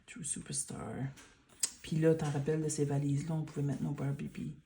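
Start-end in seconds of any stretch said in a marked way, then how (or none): 0.1-0.9 whispering. Real, not acted. Reading a label softly.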